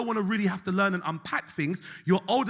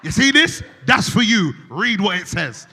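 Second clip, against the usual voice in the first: loud, slow bursting-in shout; mock shout; 7 dB louder, slower than usual. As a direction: barge in yelling at the therapist.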